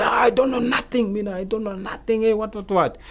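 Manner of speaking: high, rushed protest of innocence; imitated panicky denial. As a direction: deny it all in a high, pleading voice. Acts out suspect denying everything.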